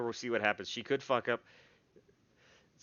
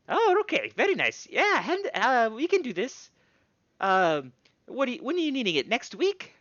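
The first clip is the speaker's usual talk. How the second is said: higher, excited, fussy smith; gnome smith voice; 8 semitones higher, 5 dB louder than usual. Acted. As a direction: chatter on like a busy artisan. GM holds smith voice.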